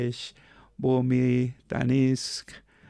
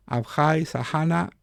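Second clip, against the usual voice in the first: ordinary talk, nothing marked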